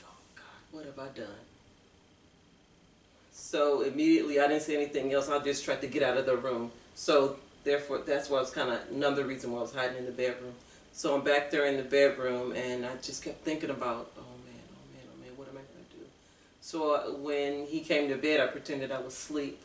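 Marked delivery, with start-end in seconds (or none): 0.0-1.4 breathless horrified whisper to herself; a frightened inner whisper; panicked whisper. Retold or imitated. Retold panicked thought after handing drink. 14.2-16.0 hushed, anxious muttering to herself; soft fretful inner voice; worried murmur. Retold or imitated. Retold worried thoughts in bedroom.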